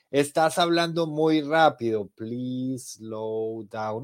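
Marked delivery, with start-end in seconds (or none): 2.1-4.0 slow plea. Shown as a demonstration. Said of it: "Ah, no me hablaban a mí".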